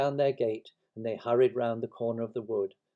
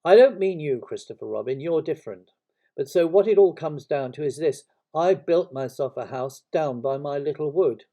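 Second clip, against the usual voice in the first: louder, higher donkey grievance; aggrieved Eeyore; 4 semitones higher, 10 dB louder than usual. Acted. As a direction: insist with a wounded air.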